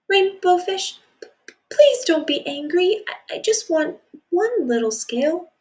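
high, stammering small voice; timid pleading. Acted. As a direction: ask shyly, afraid of anger. Little fish timidly asks again.

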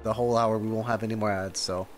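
ordinary talk, nothing marked

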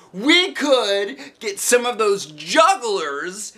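high, slow, gleeful; Polly proposing chainsaw jugglers; excited boy. A narrator's reading. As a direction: savor the crazy idea. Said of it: "real excited".

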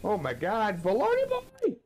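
high-pitched, astonished outburst building to a squeal; excited surprise. Real, not acted. Streamer thrilled by another fucking raid, excited surprise.

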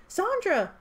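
high, loud cry of the name; cried out. A narrator's reading. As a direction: call out in alarm. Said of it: "Lampire cried".

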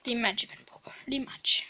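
whispered rematch; teasing whisper. Real, not acted. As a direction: whisper it playfully to the dog.

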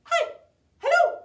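very high, loud squeak; as high as possible. Shown as a demonstration. Librarian showing toddlers the highest hello, very high. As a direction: pop it out at the top of your range. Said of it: "as high as we can".

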